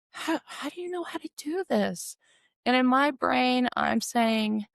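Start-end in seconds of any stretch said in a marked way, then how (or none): 0.1-2.1 very high, soft plaintive question; wondering mother. Retold or imitated. Mother asking her caring daughter, in wonder. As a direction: imitate a frail, bewildered mother.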